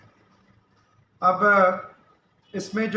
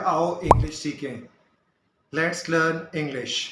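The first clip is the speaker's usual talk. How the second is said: drawn-out title; much slower than usual. Shown as a demonstration. Teacher announcing series title.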